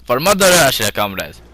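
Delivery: rally the comrades in a cheesy mock Russian accent; horrible Russian accent. Rallying his troops in the game, in a bad mock Russian accent. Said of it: "I do a horrible Russian accent".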